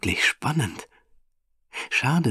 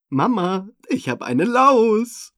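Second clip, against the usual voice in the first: put-on child calling out much higher, slow and happy; cheerful call; 14 semitones higher, 4 dB louder, slower than usual. Benny begrüßt Mama fröhlich, hohe Kinderstimme. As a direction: proudly announce it like a delighted little boy. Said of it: "ruft Benny fröhlich".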